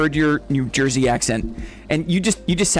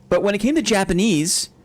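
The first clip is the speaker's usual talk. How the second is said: usual speaking voice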